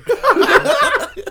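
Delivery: shrieking burst of laughter; loud high laugh. Real, not acted.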